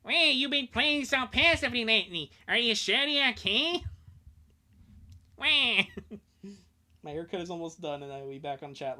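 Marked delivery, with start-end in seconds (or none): 0.1-3.8 high, loud cartoon villain voice; Waluigi voice. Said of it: "I should have done the Waluigi voice". 5.4-5.8 high, loud cartoon wah; Waluigi wah. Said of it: "the Waluigi voice".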